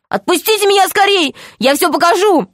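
put-on high, frantic boyish cry; acted desperate outcry; urgent plea. A narrator's reading. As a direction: beg to be let go, pitch leaping up. Boy held by troll pleads.

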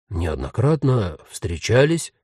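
slow, low, puzzled echo; bewildered. A narrator's reading. Ghost queries Jen's past meetings, bewildered. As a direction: repeat it haltingly as a baffled question. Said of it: "растерянно переспросил её новый знакомый".

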